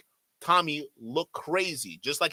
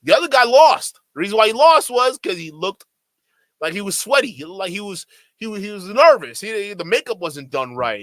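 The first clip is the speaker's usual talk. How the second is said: raised, louder heated rant; worked up; 4 semitones higher, 7 dB louder than usual. Real, not acted. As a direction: pitch it up and push hard, riled up. Heated rant about Nixon.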